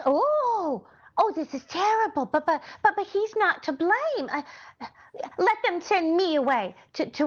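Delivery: high, upset cry of alarm; distressed. Acted. Learns George faces jail.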